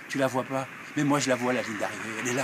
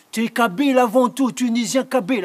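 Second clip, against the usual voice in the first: insisting father; 7 semitones higher, 8 dB louder than usual. Acted.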